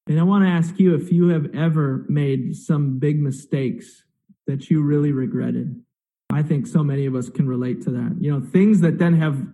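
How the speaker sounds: ordinary talk, nothing marked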